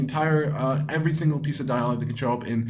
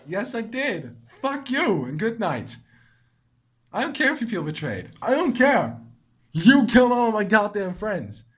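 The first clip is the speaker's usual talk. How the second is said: higher and louder, furious; angry retort; 7 semitones higher, 3 dB louder than usual. Real, not acted. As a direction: hurl it back at her. Arguing back at Monika.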